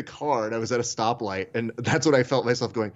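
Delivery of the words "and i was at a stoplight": ordinary talk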